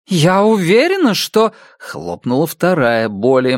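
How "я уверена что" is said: flustered. Acted. Flustered insistence, cut off.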